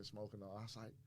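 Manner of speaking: ordinary talk, nothing marked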